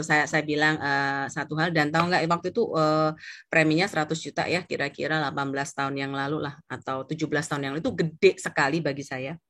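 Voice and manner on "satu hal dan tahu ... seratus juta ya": usual speaking voice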